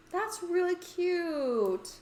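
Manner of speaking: drawn-out, high cooing over something adorable; gushing. Real, not acted. Player gushing at the aquarium shark, high slow coo. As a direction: melt over the cute shark, slowly.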